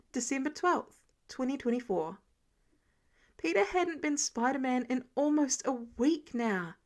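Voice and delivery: plain reading voice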